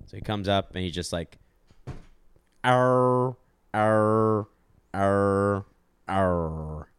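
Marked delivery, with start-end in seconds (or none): 2.6-6.9 Snape stubbing his toe. Acted. A wizard hitting his toe on a table, long descending groans. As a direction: groan four long, even, monotone cries. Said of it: "So this is Snape hitting his toe".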